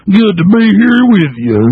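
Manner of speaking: high, slow Texas drawl caricature; mock Hillary Clinton Texas accent. Host mocking her greeting a Texas crowd, exaggerated Texas accent. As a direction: stretch out a put-on Texas greeting. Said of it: "I've seen her in Texas".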